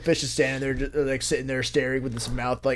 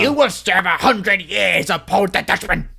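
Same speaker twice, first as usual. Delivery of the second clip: raised, put-on sea-devil voice; Davy Jones; 4 semitones higher, 4 dB louder than usual. Shown as a demonstration. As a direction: pass sentence as a ghostly ship's captain. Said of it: "it's Bill Nighy".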